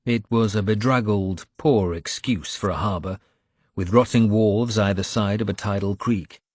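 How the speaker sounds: usual speaking voice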